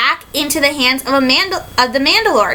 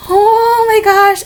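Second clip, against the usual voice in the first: slow, louder, higher oh my gosh; awed gasp; 5 semitones higher, 4 dB louder, much slower than usual. Retold or imitated. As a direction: stretch it out in stunned awe. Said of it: "And I'm going".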